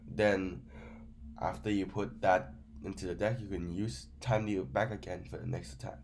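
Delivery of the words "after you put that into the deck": normal talking voice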